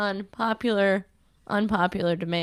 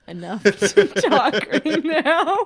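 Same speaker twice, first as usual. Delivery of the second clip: laughing; 5 semitones higher, 6 dB louder, much slower than usual. Real, not acted. Laughing mid-sentence.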